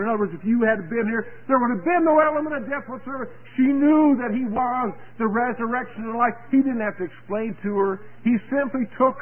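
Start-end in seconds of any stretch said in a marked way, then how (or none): none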